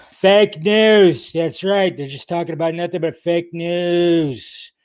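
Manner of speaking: a hint of a blustering politician, punching news; lightly voiced bombastic sneer at the media; mock pundit voice. Host mocks critics calling Collider fake news, in a blustery put-on voice.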